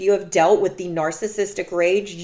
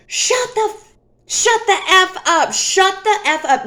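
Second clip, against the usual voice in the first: mock angry yell; 10 semitones higher than usual. Boyfriend screaming at her in the car, high furious shout. Boyfriend raging while driving.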